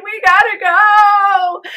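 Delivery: high, loud, slow, half-sung cry; desperate wail. Retold or imitated. Wife begging husband as the train approaches, wailing. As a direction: stretch out the plea in terror. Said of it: "She's saying".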